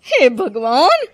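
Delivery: shocked. Acted. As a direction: gasp at the locked kitchen. Shocked at locked kitchen.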